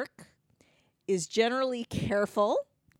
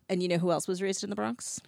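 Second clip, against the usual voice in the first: ordinary talk, nothing marked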